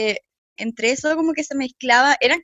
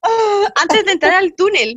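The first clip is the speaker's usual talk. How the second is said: normal talking voice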